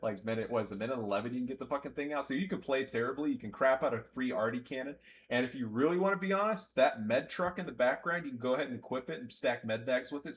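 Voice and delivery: normal talking voice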